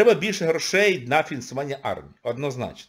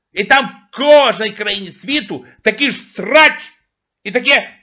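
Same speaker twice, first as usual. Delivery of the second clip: loud rant; 9 semitones higher, 10 dB louder, slower than usual. Real, not acted. Ranting at audience, loud.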